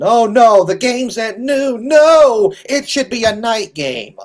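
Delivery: high, loud whiny mockery of a complaining fan; sarcastic high whining imitation. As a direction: whine it like a spoiled fan. Mocking whiny fans' complaint.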